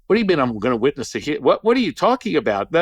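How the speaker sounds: fast, rising incredulous protest; acted disbelief. Arguing with the spirit about a healing, fast incredulous protest.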